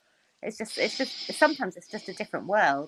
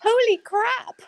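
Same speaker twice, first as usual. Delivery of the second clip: laughing repeat; 12 semitones higher, 5 dB louder, much slower than usual. Retold or imitated. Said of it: "We never say that here".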